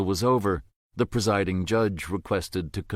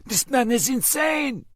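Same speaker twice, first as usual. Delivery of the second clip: very high, loud panicked yell; panicked cry; 4 dB louder than usual. A narrator's reading. Wounded prisoner crying out to others, very high and loud. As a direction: scream it out in pain and fear. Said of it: "Ahmed cried".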